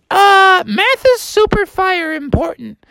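high falsetto little pupil; Molly the muskrat. Acted. Muskrat pupil offers a sum to teacher, high careful voice. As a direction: answer carefully in a high creature voice. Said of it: "And she was like".

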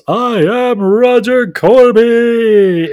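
drawn-out high, loud bellow; Roger Corby screaming. Retold or imitated. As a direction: belt it out, stretching every word. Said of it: "while Roger screams".

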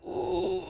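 high, strained "ooh" of pain; a soft, very high hurt gasp; pained wince. Retold or imitated. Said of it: "I would have to be like".

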